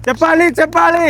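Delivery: squeaky high chant of applause; high mock cheer.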